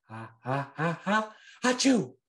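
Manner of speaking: giggles rising into a big sneeze; ha-ha-ha then a loud achoo; laughing sneeze. A narrator's reading. Fish tickled, sneezes.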